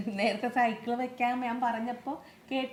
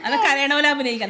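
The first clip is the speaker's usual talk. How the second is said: loud, higher-pitched prodding; teasing coaxing; 5 semitones higher, 9 dB louder than usual. Real, not acted. Adult daring a child to pretend crying, loud teasing.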